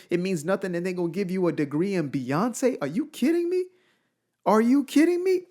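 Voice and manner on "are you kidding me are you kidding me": high, exasperated rhetorical question; incredulous outrage. Real, not acted. Vlogger ranting about a degree in [name], incredulous. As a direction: pitch shoots way up in disbelief.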